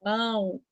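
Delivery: Mão said softly with the nose pinched; soft Mão through a held nose; nose held demo. Shown as a demonstration. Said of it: "Prenda o nariz".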